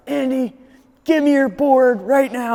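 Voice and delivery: high, loud demanding voice; imitating Brendan. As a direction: demand the board right now.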